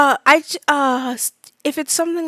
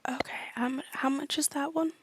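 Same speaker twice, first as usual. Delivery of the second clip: whisper; 2 semitones higher, 13 dB quieter than usual. Acted. Customer whispers the question.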